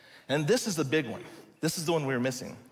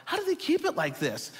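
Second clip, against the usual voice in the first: much higher, amazed car guy; freaking out; 10 semitones higher than usual. Retold or imitated. Car lover amazed at the spotless taxis, excited and high. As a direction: gush over the spotless old cars. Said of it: "I was freaking out going".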